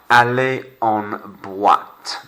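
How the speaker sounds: aller en boîte slowly, boîte as bwat; slow French model. Shown as a demonstration. Teacher models hard word for class, slow careful French. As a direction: stretch out aller en boîte for learners to copy. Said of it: "quite a difficult word to say".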